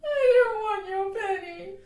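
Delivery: put-on loud, high childish sob; bawling little girl refusing; sobbing. A narrator's reading. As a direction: sob it out in a tantrum. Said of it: "sobbed Lottie".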